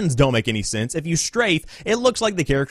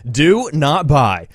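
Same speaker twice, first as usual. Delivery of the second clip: slow, deliberate, each word hit; stern slowed-down warning; emphatic warning; 2 semitones lower, 4 dB louder, much slower than usual. Retold or imitated. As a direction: spell it out slowly and firmly. Emphatic warning at the end of the review.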